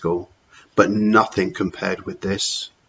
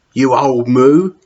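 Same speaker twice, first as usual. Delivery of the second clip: slow, louder cheeky jibe; cheeky insult; 3 semitones higher, 6 dB louder, slower than usual. Acted. George insulting Matron, slow and cheeky. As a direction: lean into the insult.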